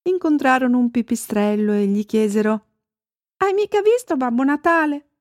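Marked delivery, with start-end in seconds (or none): 3.4-5.1 high, eager question; searching reindeer. A narrator's reading. Reindeer asking a bat after Babbo Natale, searching. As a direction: ask in a lifted storybook-animal voice.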